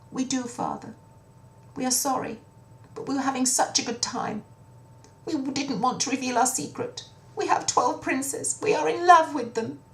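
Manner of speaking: high, meek confession; sheepish princesses. Acted. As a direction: admit it with heads down. Daughters confess to father.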